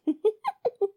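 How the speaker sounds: high little giggle. Acted. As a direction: giggle lightly to a partner. Amused laugh with partner.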